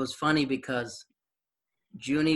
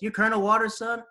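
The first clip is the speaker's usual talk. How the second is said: high, louder disbelief; surprised; 9 semitones higher, 4 dB louder than usual. Retold or imitated. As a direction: react in shock. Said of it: "he goes".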